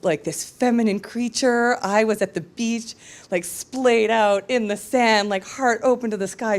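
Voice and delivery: usual speaking voice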